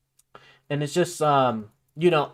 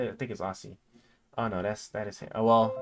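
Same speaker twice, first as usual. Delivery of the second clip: ordinary delivery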